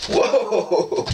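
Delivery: high startled whoa; startled high yelp; surprised whoa. Real, not acted. Button reaction.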